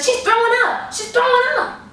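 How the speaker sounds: high, loud alarmed shouting; panicked shout. Retold or imitated. Roommate yelling that the guest is throwing up, high alarmed shout.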